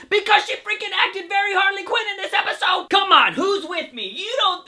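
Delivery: loud, high fan outburst; rally the viewers, shouting; excited shout. Real, not acted. Fan shouting to viewers about the episode, excited.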